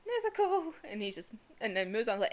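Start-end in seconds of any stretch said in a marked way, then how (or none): 0.1-0.7 high, joyful call out; calling Nezuko. Retold or imitated. Brother calling out to his sister, high joyful call. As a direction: call out her name in relief. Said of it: "And he's just like".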